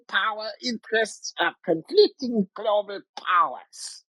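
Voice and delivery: Hail Caesar professor voice. Acted. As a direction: proclaim it like a pompous communist professor. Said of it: "in the Hail Caesar communist professor kidnapper voice".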